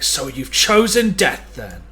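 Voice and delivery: half-screamed in anger, stretched out slowly; angry scream. Acted. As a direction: shout it as an enraged threat. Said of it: "I'm gonna just scream in anger".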